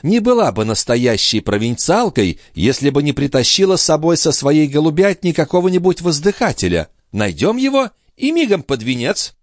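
high-pitched lady thinking aloud; scheming countess. Acted. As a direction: reason it out with haughty eagerness.

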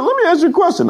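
very high-pitched mock question; crazy driver voice. Driver questions his passenger, imitated high crazy voice. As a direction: pipe it up high, acting unhinged.

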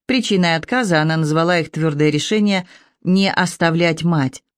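plain reading voice

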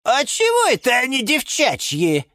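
high-pitched offended question; miffed, high character voice; indignant. A narrator's reading. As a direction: object as if personally affronted. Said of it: "возмутился Лимон Филимон".

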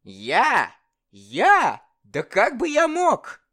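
high innocent exclaim; calm exclamation. Acted. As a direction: protest innocence calmly. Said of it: "лишь воскликнул".

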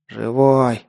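ordinary delivery, nothing marked

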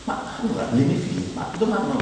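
usual speaking voice

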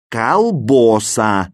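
«колбоса» slowly, with a stressed O in the middle; drawn-out «колбоса», first vowel A, second O; misspelling read out. A narrator's reading. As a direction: sound out «колбоса» with long BO instead of BA. Misspelt word read as written.

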